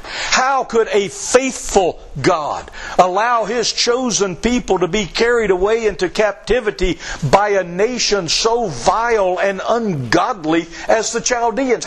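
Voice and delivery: ordinary talk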